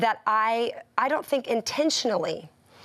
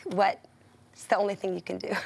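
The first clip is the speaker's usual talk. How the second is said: ordinary talk, nothing marked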